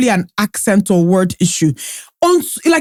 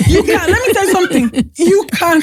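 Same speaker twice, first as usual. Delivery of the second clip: laughing while talking; 7 semitones higher than usual. Real, not acted. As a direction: talk through giggles. Laughing through a point.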